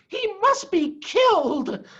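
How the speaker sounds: high, slow sneering voice; nasty farmer Bean. Acted. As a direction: spit it out like a spiteful farmer. Bean's voice in read story.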